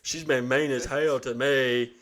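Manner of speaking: mock dopey dad. Dad watching mom shake, slow and dopey. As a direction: play the dad as a slow dimwit. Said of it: "Dad's looking there like".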